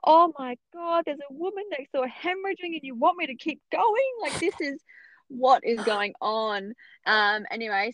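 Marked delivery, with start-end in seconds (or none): 0.0-6.7 can't believe they want her to go on; incredulous. Retold or imitated. Mother in labour to her midwife, higher, incredulous. Disbelief at being told to continue.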